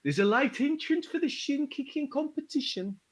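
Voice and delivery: high, carnival barker calling out to the crowd; hype man announcer. Acted. Hype man calls the crowd to the shin-kicking, barker voice. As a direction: gather them round like a fairground crier.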